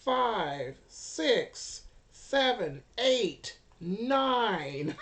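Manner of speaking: loud, high count; excited counting. Real, not acted. Game master counts Vivian's successes, loud and excited. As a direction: call out each number with punch.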